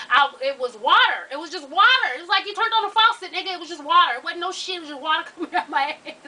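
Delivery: loud, high, insistent disbelief; excited emphasis. Real, not acted. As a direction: hammer it home, almost shouting.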